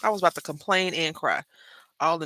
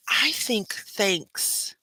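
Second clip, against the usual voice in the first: whispered aside; 4 semitones higher, much slower than usual. Real, not acted. Muttering to herself.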